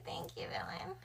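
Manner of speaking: high breathy whispered thanks. Real, not acted.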